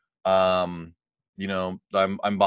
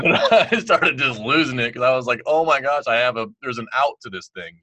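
breaking into relieved laughter, louder and higher; laughing and losing it; 5 semitones higher, 7 dB louder than usual. Real, not acted. Telling the host about the job offer, laughing with relief. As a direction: laugh through the words as it all spills out.

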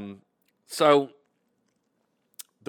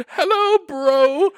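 much higher, louder hello bro; squeaky bro; 11 dB louder, much slower than usual. Shown as a demonstration. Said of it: "I would go".

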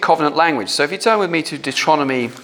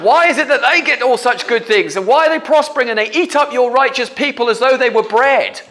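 indignant complaint; 9 semitones higher than usual. Retold or imitated. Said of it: "complete anger and, and the unfairness".

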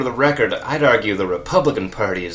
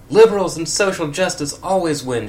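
higher, louder victory cry; heroic shout; 8 semitones higher, 4 dB louder than usual. A narrator's reading. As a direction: declare it like a cartoon hero. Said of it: "shouted Sonic".

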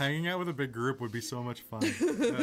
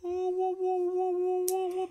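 much higher, louder wobbling whoa; wavering high whoas; 5 dB louder, slower than usual. Acted. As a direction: ride a wave of strange feeling. Players feel the eye's tingle.

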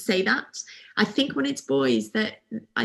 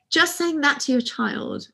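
usual speaking voice

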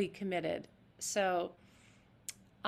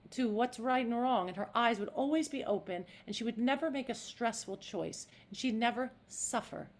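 ordinary talk, nothing marked

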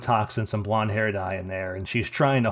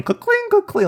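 high, loud door-bell imitation; imitated bell clanging; 7 dB louder than usual. Imitating the restaurant's door bell, loud high clanging. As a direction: mimic a rattling bell, pitched way up. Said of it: "with the fucking bell going".

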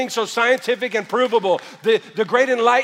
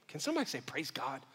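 soft prompt; 5 semitones lower, 15 dB quieter, faster than usual. Shown as a demonstration. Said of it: "Just say it like you mean it".

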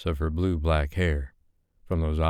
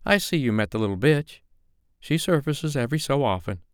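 higher, sneering diva; catty dame; 9 semitones higher than usual. A narrator's reading. Madam M mocks the girl, higher catty sneer. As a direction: toss it off with disdain.

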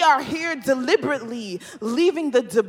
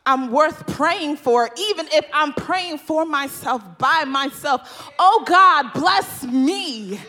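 escalating preaching refrain; 8 semitones higher, 7 dB louder than usual. Acted. Preacher pleading for blessing before the congregation, building to a shout. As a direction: bless me repeated, climbing higher and louder.

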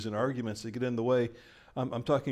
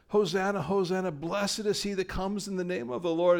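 joyful hosanna cry; 9 semitones higher than usual. Retold or imitated. Children leading worship, crying out joyfully, higher pitch. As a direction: shout praise up high. Children shouting praise on Palm Sunday.

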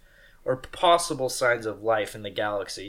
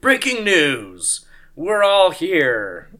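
loud, slow, higher-pitched news flash; announcer voice; 5 semitones higher, 8 dB louder, much slower than usual. Pretend. Newscaster breaking news to listeners, announcer voice. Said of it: "do an announcer voice".

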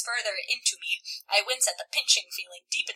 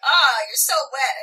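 mock lover; 8 dB louder, slower than usual. Mocking partner's line.

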